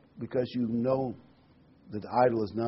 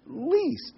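"Least" hit much higher, drawn out and falling; stress on least. Shown as a demonstration. Preacher singling out the word for the congregation, stressed. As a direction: lift "Least" high and let it drop. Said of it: "I want to emphasize the word least".

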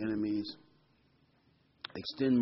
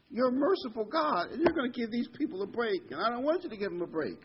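high-pitched, protesting mock complaint; 10 semitones higher than usual. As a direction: complain in a pitched-up voice, mimicking a sulky prophet. Acting out Jonah's complaint to God.

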